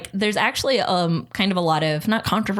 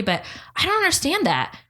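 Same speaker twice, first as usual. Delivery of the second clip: high-pitched imitation of a puzzled mother; baffled mom; 11 semitones higher than usual. Daughter imitating mom's reaction on the phone, baffled. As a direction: climb higher and higher in innocent bewilderment.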